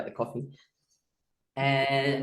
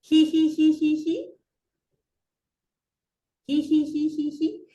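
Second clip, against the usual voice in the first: high hee laugh; 9 semitones higher than usual. Shown as a demonstration. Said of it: "just try that out".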